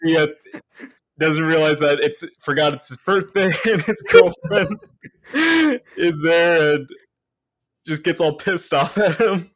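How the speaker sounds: high, loud voice bubbling with laughter; laughing while talking. Real, not acted. Podcaster retelling the forgotten birthday, breaking up laughing. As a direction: crack up and push the words through.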